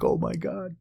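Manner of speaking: high, soft imitation of an amazed buddy; mock stage-hushed astonishment; hushed amazement. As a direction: squeeze it out high and quiet. Buddy peeking in, retold.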